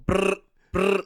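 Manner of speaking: imitated gun bursts, brr brr; gunfire noise. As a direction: rattle it off like an Uzi. Imitating gunfire from car window.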